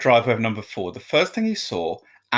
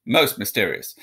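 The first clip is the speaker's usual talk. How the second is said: ordinary delivery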